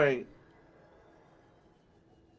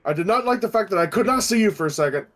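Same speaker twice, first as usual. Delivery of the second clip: usual speaking voice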